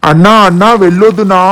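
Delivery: loud, pleading call to stop him; urgent call. Acted. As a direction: call him back before he steps out. Stopping brother from going out.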